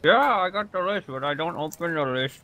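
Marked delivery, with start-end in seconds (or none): 0.0-1.1 talking with a mouthful of food; words round a bite of food; mouth full. Real, not acted. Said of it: "don't speak with your mouth full".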